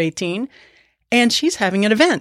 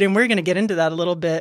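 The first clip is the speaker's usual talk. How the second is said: ordinary talk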